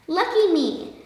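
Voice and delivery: high, joyful exclamation; exclaiming. A narrator's reading. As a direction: cheer at your good luck. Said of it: "exclaimed La Hormiga".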